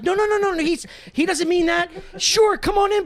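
high-pitched eager friend smoothing it over; lightly acted, high and eager; hurried mock reassurance. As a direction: imitate a friend rushing to welcome someone. Acting friend overruling him, welcoming kid.

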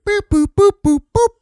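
high, loud beeping sounds; machine beeps. Pretend. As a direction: beep like a meter. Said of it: "It'll be like".

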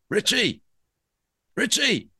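high-pitched call through a closed door; calling out a name. Retold or imitated. Landlord calling the hungover guest through the bedroom door, high call.

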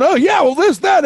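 high-pitched mock rambling; mock chatter. Host imitating someone sixty-eight games into a season, high mock chatter. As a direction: rattle it off as a high-voiced imitation.

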